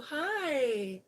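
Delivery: normal talking voice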